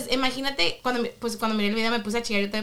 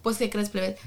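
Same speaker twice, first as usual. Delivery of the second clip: ordinary talk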